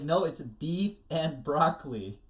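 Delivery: stubborn retort. Acted.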